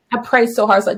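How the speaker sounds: ordinary delivery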